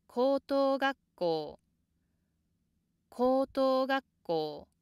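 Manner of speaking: 高等学校 on a long high plateau, then dropping; start high. Shown as a demonstration. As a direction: hold 高等学校 high until the downstep before こう. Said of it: "which start with the high pitch".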